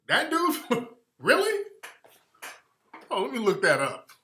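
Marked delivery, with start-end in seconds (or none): 0.1-1.6 shocked, laughing; surprised disbelief. Real, not acted. Host reacting to news the rapper is Filipino, high laughing disbelief. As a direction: pitch jumps way up with a laugh.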